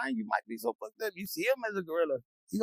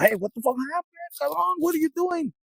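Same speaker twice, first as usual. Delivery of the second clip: higher, louder annoyed complaint; frustrated outburst; 10 semitones higher, 4 dB louder than usual. Retold or imitated. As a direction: blurt out his frustration. Frustrated man voiced.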